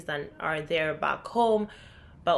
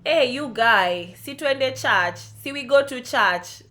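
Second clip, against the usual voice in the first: put-on loud, slow, higher strong Kenyan accent; 5 semitones higher, 5 dB louder, slower than usual. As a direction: lay the Kenyan accent on thick to friends. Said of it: "the accent came on very, very strong".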